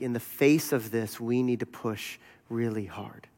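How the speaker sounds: low, soft, solemn retelling; grave warning. Retold or imitated. Bonhoeffer warning the visitor on the hilltop, quietly grave.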